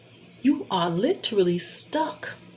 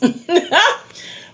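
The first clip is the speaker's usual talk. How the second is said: real high-pitched chuckle; laugh; 9 semitones higher than usual. Real, not acted. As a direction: break into a short high laugh. Laughs after excusing those moms.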